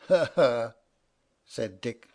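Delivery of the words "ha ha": short spoken "ha, ha" with a bitter edge; mock laugh, bitter and deliberate. A narrator's reading. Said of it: "laughing bitterly".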